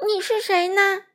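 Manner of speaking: high, loud nervous question; nervous little fish. Acted. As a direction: ask bravely but shaking. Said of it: "闪闪回答说".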